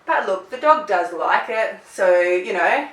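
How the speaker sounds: ordinary delivery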